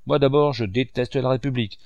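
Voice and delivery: usual speaking voice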